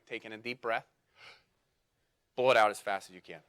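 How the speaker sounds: forceful instruction, blow out fast; lung function test. Shown as a demonstration. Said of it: "you're gonna do this too".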